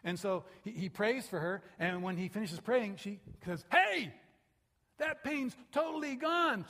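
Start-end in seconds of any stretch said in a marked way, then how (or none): 3.7-6.7 high, loud and slow, shouting in joy; healed woman shouting her amazement to Kent; amazed cry. Retold or imitated. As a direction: cry out that the pain is gone.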